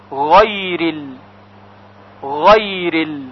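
غیرل with a soft throaty gh, gliding into the next word; correct soft ghayn. Shown as a demonstration. Teacher shows soft correct ghayn pronunciation.

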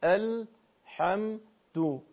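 "الحمدو" slowly split into syllables with light, narrow vowels; thin Arabic fatha. Shown as a demonstration. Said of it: "حرف ها به صورت درشت و پرحجم نیست". («الحمدو» هجا به هجا با فتحهٔ نازک)

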